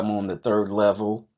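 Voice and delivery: ordinary talk, nothing marked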